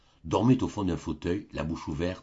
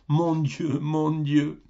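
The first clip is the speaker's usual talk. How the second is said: higher, louder flustered exclamation; an old man beside himself with joy; overjoyed old man; 8 semitones higher, 4 dB louder, much slower than usual. A narrator's reading. (vieil homme fou de joie)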